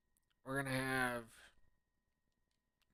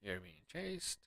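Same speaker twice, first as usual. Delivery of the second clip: ordinary delivery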